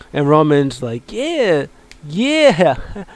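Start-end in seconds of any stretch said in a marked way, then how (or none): 1.1-3.1 hyped agreement. Retold or imitated. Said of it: "Man, I'm so good at acting".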